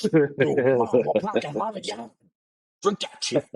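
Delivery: lightly hammed Boston party-dude accent. Showing the host how Boston sounds, mock Boston accent. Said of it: "That's Boston".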